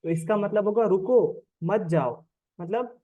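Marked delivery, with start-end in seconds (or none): 0.8-2.2 slow "रुको", break, then "मत जाओ"; pause after रुको. Shown as a demonstration. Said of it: "comma लगा हुआ है". ("रुको" के बाद ठहराव, फिर "मत जाओ")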